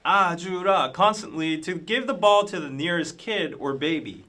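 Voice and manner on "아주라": chanting call from the stands; crowd shout. Retold or imitated. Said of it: "the crowd shouts".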